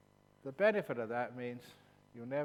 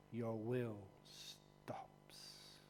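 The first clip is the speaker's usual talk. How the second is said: whisper; 3 semitones lower, 8 dB quieter, much slower than usual. Real, not acted. Deep meditation description.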